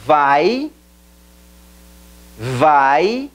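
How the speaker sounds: vai said as one gliding syllable, vaj; pronouncing vai. Shown as a demonstration. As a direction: model vai twice for repeating. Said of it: "el verbo ir". (pronunciación de vai)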